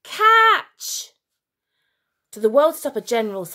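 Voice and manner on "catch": high, loud shout; girl throwing to her friend; shouted call. A narrator's reading. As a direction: yell it as you throw. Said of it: "she shouted".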